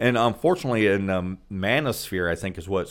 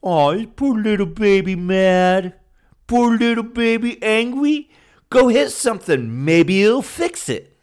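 put-on high, slow, louder taunting baby voice; sneering baby talk aimed at an angry young man; mocking baby talk; 11 semitones higher, 6 dB louder than usual. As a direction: coo it like you're taunting a sulking toddler. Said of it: "they'd be like".

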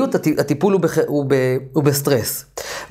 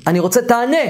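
raised-pitch demand, only mildly shouted; mock yelling; 7 semitones higher than usual. Therapist demanding an instant answer from a hesitating client, mock yell. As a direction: press for an answer in a half-shouted, higher voice. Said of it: "ואני מתחיל לצעוק פה בטיפול".

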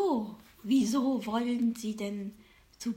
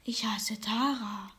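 fortune teller. Acted. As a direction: whisper it like a secret. Fortune teller corrects name.

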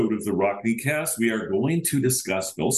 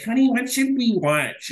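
sweet spouse voice; 4 dB louder than usual. Retold or imitated. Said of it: "you're like".

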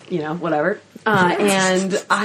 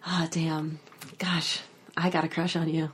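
quietly smitten; 7 dB quieter than usual. Acted. Inner thought on seeing a longtime crush, softly smitten. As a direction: murmur it like a private admission.

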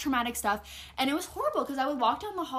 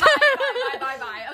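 laughing goodbye; 11 semitones higher, 10 dB louder than usual. Real, not acted. Teasing friend, mock goodbye.

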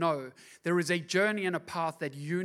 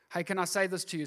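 ordinary talk, nothing marked

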